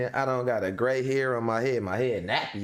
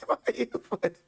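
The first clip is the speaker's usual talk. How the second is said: laughing; 13 semitones higher, much faster than usual. Real, not acted. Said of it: "laughing at mom's voice".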